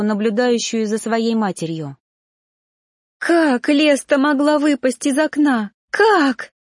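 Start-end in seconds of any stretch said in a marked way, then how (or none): 3.2-6.5 high cry rising to a piercing last word; anguished wailing. A narrator's reading. Mother wails over daughter's fall, anguished. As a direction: wail the question in grief. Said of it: "рыдала она".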